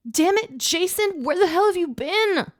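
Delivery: high, loud shriek. Acted. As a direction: shriek at him. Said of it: "I shrieked".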